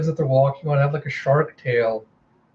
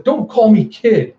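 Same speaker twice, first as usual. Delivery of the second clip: high, loud, slow retort; indignant girl; 7 semitones higher, 6 dB louder than usual. Acted. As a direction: object with drawn-out indignation. Girl snaps back at adult.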